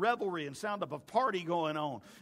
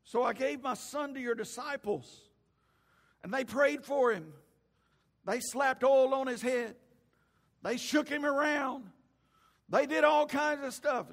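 higher, emphatic recounting, building line by line; exasperated account; 11 semitones higher, 9 dB louder than usual. Retold or imitated. Father telling Jesus what the disciples tried, building exasperation. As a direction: list each failed attempt with mounting force.

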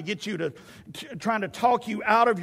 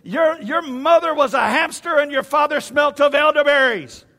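loud, high, hammed-up insult; trash-talking shout; 7 semitones higher, 7 dB louder than usual. Goliath hurling a movie insult at the army, mock shouting. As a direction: yell a mock taunt across the battle lines. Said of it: "he would sit there and trash talk".

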